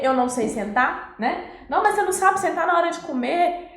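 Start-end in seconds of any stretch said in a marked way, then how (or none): 1.7-3.7 higher and fast, impatient nagging; exasperated scolding. Retold or imitated. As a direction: snap at the child who won't sit at dinner. Parent scolding child at table.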